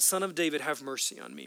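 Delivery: ordinary talk, nothing marked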